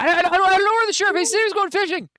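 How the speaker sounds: very high, loud, fast panicked squeal; panicked denial. Acted. Townsman intimidated about the missing sheriff, panicked. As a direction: stammer it out in a frantic high voice. Twigs intimidated, dragged into questioning.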